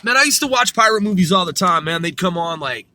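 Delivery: normal talking voice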